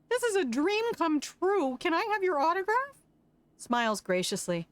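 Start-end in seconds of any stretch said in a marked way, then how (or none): 0.1-3.0 high gushing autograph plea; high excited fan voice; starstruck fan. Acted. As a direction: beg for an autograph, thrilled.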